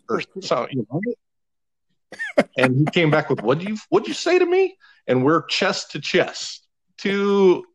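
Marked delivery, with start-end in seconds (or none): 3.4-4.8 much higher, fast outraged what'd you say; the member squares up, furious; challenging yell. Retold or imitated. Member challenging narrator, ready to fight.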